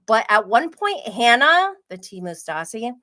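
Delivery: ordinary talk, nothing marked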